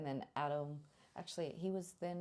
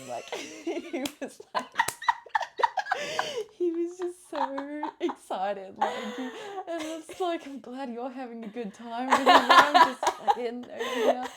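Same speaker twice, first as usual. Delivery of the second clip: laughing; 11 semitones higher, 13 dB louder, slower than usual. Real, not acted. Laughing about Adam's excitement.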